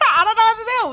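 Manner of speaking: helpless laughing breakdown; laugh-crying. Real, not acted. Panicking over the flooded apartment.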